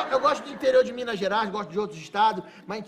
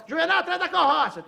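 higher, loud call; 7 semitones higher, 6 dB louder than usual. Retold or imitated. The farm owner calls his foreman once more, loud. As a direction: summon Juvenal again.

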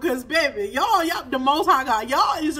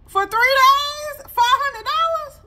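high, slow and loud, stretching out the shock; can't believe the bill amount; outraged disbelief; 12 semitones higher, 7 dB louder, slower than usual. Real, not acted.

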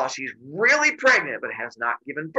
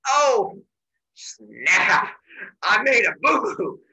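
oops realization; 4 semitones higher, 5 dB louder, much slower than usual. Retold or imitated. Zeus realises his blunder.

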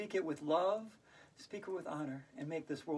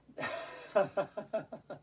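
breathy laugh; 3 semitones higher than usual. Pretend. Teacher showing kids how to laugh, airy giggle. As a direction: laugh lightly as an example for the kids. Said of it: "Laugh out loud".

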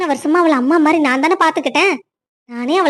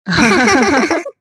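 playful laugh; 2 semitones lower than usual. Acted. Wife laughs as she keeps dodging her husband. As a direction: laugh at him as you dodge away.

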